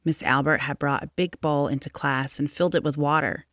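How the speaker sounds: plain reading voice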